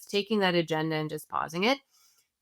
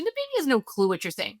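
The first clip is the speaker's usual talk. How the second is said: ordinary talk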